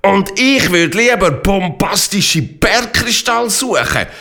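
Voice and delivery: calling out excitedly. Acted. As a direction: shout out the crystal idea with delight. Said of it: "ruft die Gotara".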